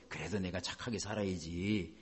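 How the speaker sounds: quiet inner voice. Retold or imitated. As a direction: mutter it to yourself. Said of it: "이런 게 계속 있어요".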